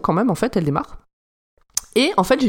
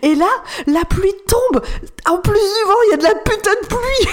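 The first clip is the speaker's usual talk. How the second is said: exasperated; 11 semitones higher, 3 dB louder than usual. Real, not acted. Exasperated at the cliché storm.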